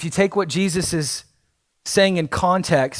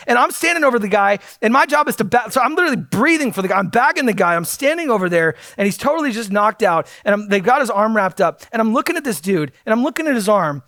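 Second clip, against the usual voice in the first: ordinary delivery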